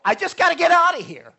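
panicked cry. Retold or imitated. Retold panic, wanting to escape.

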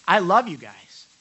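love stressed, said loudly; emphatic i love you guys. Shown as a demonstration. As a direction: lean in and say it with feeling. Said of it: "can I tell you what".

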